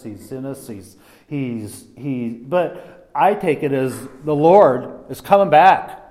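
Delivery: normal talking voice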